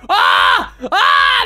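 loud sudden yell; short, full-force startled shrieks; startled scream. Real, not acted. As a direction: scream out in alarm. Startled finding the murderer.

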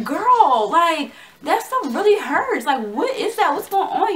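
high, loud, hammed-up wail; freaking out. Patient complaining to the nurse about the IV, acted freaking out. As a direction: wail it in exaggerated pain and panic. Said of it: "I'm in there freaking out".

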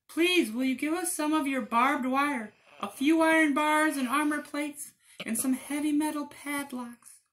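very high small voices; tiny wolves deciding; squeaky little wolves. Acted.

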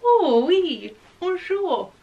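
high, loud hammed-up French voice; sing-song fake French greeting; mock French accent. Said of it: "Very French, isn't it?".

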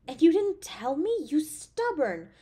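loud, high exasperated scold; worried scolding. Acted. Girlfriend scolding her partner for hiding a burn, loud and exasperated. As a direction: tell them off out of worry.

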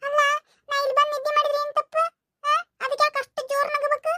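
put-on high, offended child voice; a little girl hurt at being laughed at; sulky little girl. Child Chinni complains being mocked.

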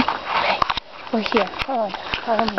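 soft, hushed tour guide. Pretend. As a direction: lead them in quietly like a nature host. Hushed nature guide opening.

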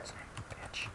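breathless whispered swear under the breath; whispered curse. Real, not acted. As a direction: curse to yourself, barely audible. Muttered curse at dead end.

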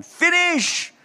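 sudden high, loud "Finish!"; exclaiming. Retold or imitated. Onlooker declaring Abraham's bargaining is finished, a loud exclaim. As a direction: blurt it out like a verdict. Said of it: "And you say".

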